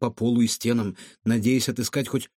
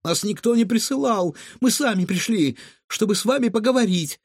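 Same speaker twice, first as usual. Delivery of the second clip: put-on high little-girl protest; timid babbling; 8 semitones higher than usual. A narrator's reading. Girl explains herself to the menacing cook, timid babbling. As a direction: plead innocence in a high voice. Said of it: "пролепетала Илона".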